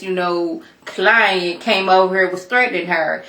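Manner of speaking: usual speaking voice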